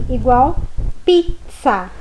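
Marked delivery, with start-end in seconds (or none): 1.0-1.9 pizza with zz said ts; double z as ts. Shown as a demonstration. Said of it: "como se fosse um T S".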